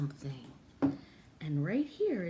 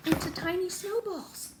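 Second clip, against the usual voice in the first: rising high and louder; excited; 5 dB louder than usual. Real, not acted. Teacher suggesting paper snowballs, climbing to high excitement. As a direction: get more and more thrilled.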